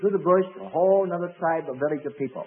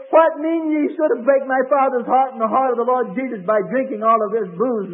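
loud, raised mock rebuke; child rebuking a drunkard; 5 semitones higher, 6 dB louder than usual. Six-year-old Spurgeon scolding the tavern drinker, loud mock rebuke. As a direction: scold him like a stern little preacher. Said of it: "And he says".

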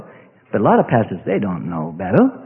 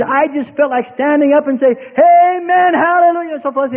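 high, lifted retold cry of amen hallelujah; excited amen; 8 semitones higher than usual. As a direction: echo an excited worshipper. Retold visitor wanting to shout amen.

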